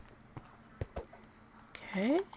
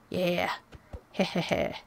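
ordinary talk

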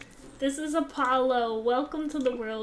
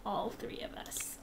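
whispered; 9 dB quieter than usual. Real, not acted. Whispered then repeated plain.

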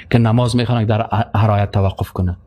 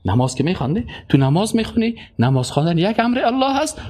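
ordinary talk, nothing marked

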